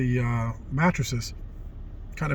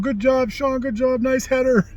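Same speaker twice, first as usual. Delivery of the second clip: high, loud, excited cheering of praise; cheering teammates; 12 semitones higher, 4 dB louder than usual. Retold or imitated. Teammates cheering Sean after his header, excited. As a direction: cheer him on like the whole team.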